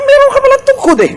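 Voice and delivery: playful high voice of God to Moses; voicing God.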